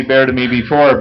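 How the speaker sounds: usual speaking voice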